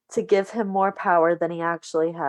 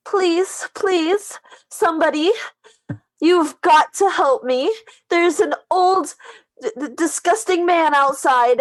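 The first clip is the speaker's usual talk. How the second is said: high, pleading, breathless damsel voice; damsel in distress voice; 12 semitones higher, 5 dB louder than usual. Acted. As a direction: beg for help like a helpless damsel. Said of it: "in her best damsel in distress type voice".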